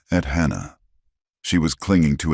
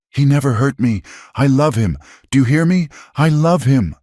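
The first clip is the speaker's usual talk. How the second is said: passionate outcry; 10 semitones higher, 4 dB louder than usual. A narrator's reading. Hannah defies the crowd.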